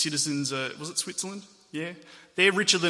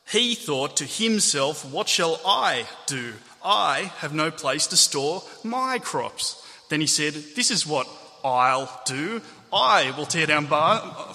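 slow, loud punch on every "I" and "my"; drawn-out emphasis on "He", "himself", "I" and "my"; stress on I and MY; 5 dB louder, much slower than usual. Shown as a demonstration. Preacher reading the parable to show self-absorption, heavily stressing "I" and "my". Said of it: "I'll read it with particular emphasis".

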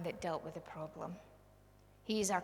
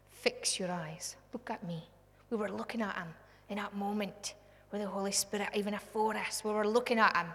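usual speaking voice

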